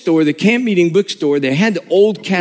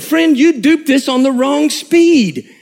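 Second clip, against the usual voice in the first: indignant complaint; 8 semitones higher, slower than usual. Retold or imitated. Complaining to bookstore clerk.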